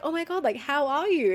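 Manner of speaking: imitating a friend's bright hello; mock excited greeting. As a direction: gush the greeting again. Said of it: "he's like".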